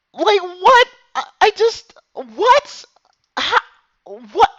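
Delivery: high, loud repeated what; stunned disbelief. Real, not acted. Streamer still reeling from the mistake, squeaking in disbelief.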